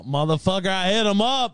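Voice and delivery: high excited rap quote; quoting [name]. Retold or imitated. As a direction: yell it like the [name] intro. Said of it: "I wanna hear hit 'em up now".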